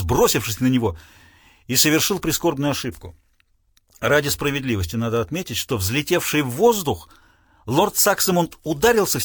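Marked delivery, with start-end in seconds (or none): none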